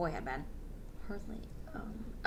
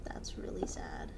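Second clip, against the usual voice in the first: whisper; 18 dB quieter than usual. Real, not acted. Reacting to sad chapter ending.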